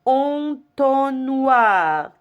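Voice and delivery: ENTONNOIR stretched out, en-ton-noir; teacher modelling en-ton-noir for repetition; syllable by syllable. Shown as a demonstration. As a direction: draw out each syllable of entonnoir very slowly. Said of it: "répétez".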